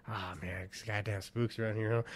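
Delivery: lightly voiced old guy, low and soft; older man's swagger. Older acquaintance talking casually to a buddy, swaggering. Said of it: "swagger to him where he talks".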